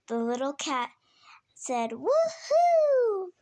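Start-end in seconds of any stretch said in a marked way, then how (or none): 1.9-3.3 high, loud, long woohoo; excited cheer. Acted. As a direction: cheer like a thrilled little cat. Little cat cheers at the introduction.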